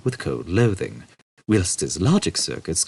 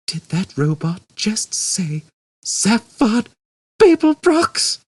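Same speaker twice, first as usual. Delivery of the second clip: much higher and louder, climbing to a squeak; incredulous; 5 dB louder, slower than usual. Acted. Ford asking Arthur in stunned disbelief. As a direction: disbelief building to a yelp.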